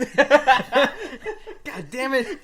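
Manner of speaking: panicked laughing yell. Real, not acted. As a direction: wail it out in panic. Panic in crowded bathroom.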